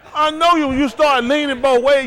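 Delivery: high, loud call-out; loud teasing accusation. Real, not acted. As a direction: call them out at full voice. Host calls out a player.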